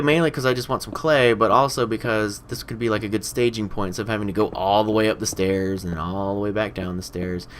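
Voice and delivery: ordinary talk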